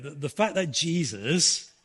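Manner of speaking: normal talking voice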